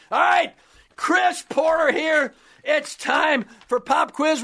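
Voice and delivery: high, slow announcer voice; announcer intro. Acted. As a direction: introduce the segment big.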